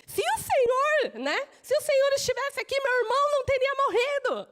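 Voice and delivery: a flustered sister rushing her complaint; flustered Martha. Retold or imitated. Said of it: "já imagino ela, Alvoroçada".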